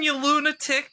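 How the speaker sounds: high, loud exasperated yell; yelling at someone. Acted. Character yelling at a lunatic stamping the fire. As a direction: yell at the fool stomping on fire.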